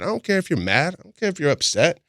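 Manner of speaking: high-pitched indignant brush-off; retold dismissive retort. Man retelling retort to partner saying she's mad, mock indignant.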